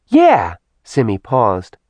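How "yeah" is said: put-on high, chattering little girl voice; childlike demon voice. A narrator's reading. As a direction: tattle on her like a child. Simi character voice.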